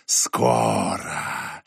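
very low, slow drawn-out hiss; whistling whisper, low. Acted. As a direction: drag it out deep and eerie. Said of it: "свистящий женский шёпот".

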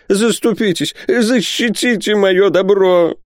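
high, drawn-out desperate plea; distraught pleading. A narrator's reading. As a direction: beg slowly and shrilly for protection. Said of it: "воскликнул Исаак Хакабут".

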